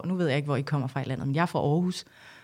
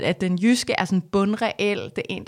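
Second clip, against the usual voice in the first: usual speaking voice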